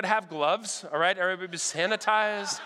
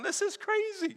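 laughing amazement; 12 semitones higher than usual. Retold or imitated.

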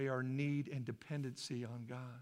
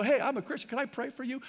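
higher, louder, acted friendly approach; eager offer; 8 semitones higher, 7 dB louder, faster than usual.

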